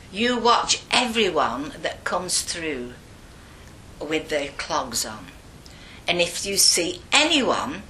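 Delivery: slow, emphatic nun giving orders; stern Mother Imelda. Retold or imitated. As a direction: lay down the rule, word by word.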